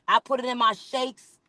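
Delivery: ordinary talk, nothing marked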